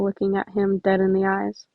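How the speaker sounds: ordinary delivery, nothing marked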